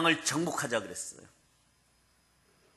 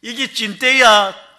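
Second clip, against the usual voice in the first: loud, slow, higher Gangwon dialect 이게 찐때야; Gangneung dialect; 6 semitones higher, 9 dB louder, much slower than usual. Retold or imitated. Landlady startling a student, loud slow Gangwon dialect. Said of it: "그 동네 가면 이렇게 표현해요".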